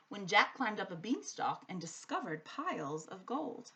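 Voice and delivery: plain reading voice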